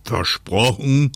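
slow, drawn-out checking question; North Wind asking. Acted. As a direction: ask it slowly to make quite sure. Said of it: "fragte der Nordwind, um ganz sicher zu gehen".

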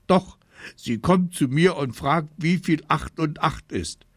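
put-on high, tearful child's protest; hammed-up furious whimpering; crying with rage. A narrator's reading. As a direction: tattle to mum, crying angrily. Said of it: "Ich weinte vor Wut ganz laut".

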